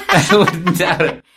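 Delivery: laughing. Real, not acted. Laughing agreement in chat.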